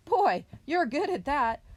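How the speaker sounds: high, loud amazed praise; admiring cry. A narrator's reading. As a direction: exclaim it as an impressed storybook bear. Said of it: "he cried".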